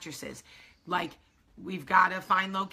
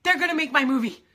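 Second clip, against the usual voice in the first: high, loud, thrilled cry of joy; ecstatic outburst; 10 semitones higher, 7 dB louder than usual. Retold or imitated. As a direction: shout the news to your friend. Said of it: "And I was like".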